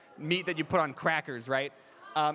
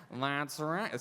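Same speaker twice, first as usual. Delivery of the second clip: quick repeat in skeleton villain voice; Death; 2 semitones lower than usual. Shown as a demonstration. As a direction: toss it off in a Skeletor-like voice. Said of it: "I can say it over and over".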